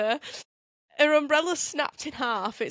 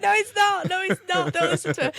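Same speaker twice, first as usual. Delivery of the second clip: protesting; 7 semitones higher, 3 dB louder than usual. Retold or imitated. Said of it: "I was like".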